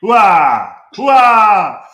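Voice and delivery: booming military battle cry; loud gung-ho soldier. Real, not acted. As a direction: bellow hooah like a drill-field soldier.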